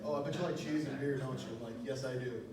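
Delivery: ordinary talk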